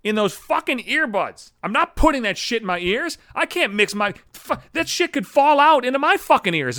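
high-pitched, acted disgusted outrage; exasperated, panicky tirade; outraged inner rant. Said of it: "is what I'm thinking".

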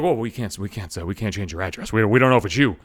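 a hint of a condescending rep; mocking bank rep. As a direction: mimic the cautious rep.

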